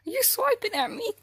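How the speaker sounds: surprised at the cat's swipe; startled outrage. Real, not acted. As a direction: yelp it as the cat lashes out. Cat swipes at her.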